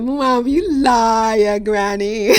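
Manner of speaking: put-on proud small child replying in her granny's dialect; childlike answer. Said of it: "And I said".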